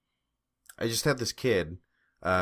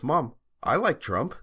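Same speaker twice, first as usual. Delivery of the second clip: put-on slow, higher-pitched teen addressing his mom; imitated protesting son; 7 semitones higher, 3 dB louder than usual. Son speaks up to his mom, imitated protest in a higher voice. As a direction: lightly mimic a kid speaking up to his mother.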